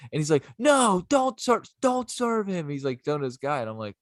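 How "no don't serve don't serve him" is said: slow, very high-pitched plea; high mock pleading. Brother telling bartender not to serve, high plea. Imitates man begging bartender.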